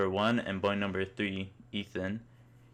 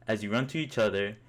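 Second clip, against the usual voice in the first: ordinary delivery, nothing marked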